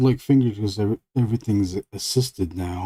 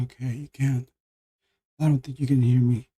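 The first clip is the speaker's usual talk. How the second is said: ordinary delivery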